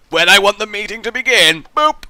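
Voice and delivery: high, loud evil-boss announcement; villain boss voice. Pretend. Boss to his staff, a loud villain voice. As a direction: boom it out like a gloating villain.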